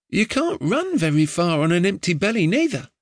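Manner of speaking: ordinary delivery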